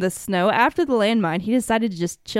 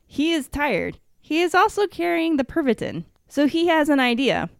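dramatic storytelling; 7 semitones higher than usual. A narrator's reading. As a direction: tell it like a suspense tale.

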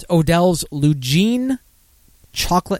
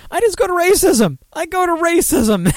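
high, amused disbelief; giddy squeal. Real, not acted. Laughing at how his show keeps going, high and giddy.

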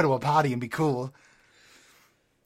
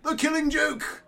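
Joker voice; 9 semitones higher than usual. Acted. Joker character.

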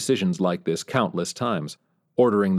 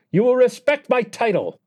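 much higher mocking dictator's whine with a Korean accent; high-pitched whine; 5 dB louder than usual. North Korean leader mocking the US president, high Korean-accented whine. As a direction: sneer and jeer in a shrill Korean-accented whine. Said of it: "that high-pitched whine".

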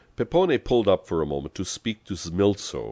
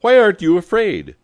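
bellowing; 13 dB louder than usual. A narrator's reading. Pepone to his unafraid passenger, bellowing. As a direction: bellow it in exasperated disbelief. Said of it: "he bellowed".

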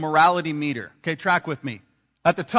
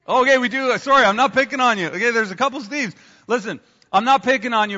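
louder, higher, laughing it off; loud playful apology; 4 semitones higher, 7 dB louder than usual. Real, not acted. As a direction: call out over the room, teasing. Laughing with congregation.

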